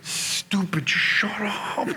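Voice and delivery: whispered mock self-rebuke; regretful self-scolding. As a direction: whisper it to yourself, kicking yourself. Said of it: "you know he regretted".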